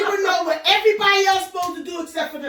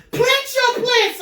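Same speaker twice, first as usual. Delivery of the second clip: dismissive retort; 6 semitones higher than usual. Retold or imitated. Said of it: "I said".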